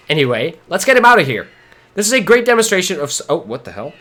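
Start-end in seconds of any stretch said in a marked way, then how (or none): none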